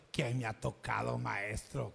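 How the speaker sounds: mock his question under your breath; mocking mimicry. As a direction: disciples mocking the teacher's question, low and sarcastic. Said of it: "con ironía se lo estaban diciendo".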